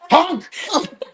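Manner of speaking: high, loud honks; goose honk. Pretend. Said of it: "in the parking lot going".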